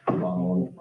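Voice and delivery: low flat robot voice. Shown as a demonstration. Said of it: "I say it like a robot".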